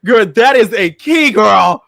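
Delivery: loud, high mock hype; hyped sarcastic praise. Host hyping a song sarcastically, loud. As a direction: sell it with fake excitement.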